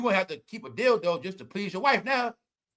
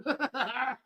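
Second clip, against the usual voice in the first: laughing; 3 semitones higher, 8 dB quieter than usual. Real, not acted. Laughing at own news.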